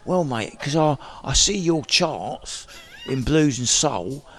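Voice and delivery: put-on a light London accent, slow; hint of a London accent; London accent impression. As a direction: lightly imitate a London lad. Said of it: "I won't do his accent anymore".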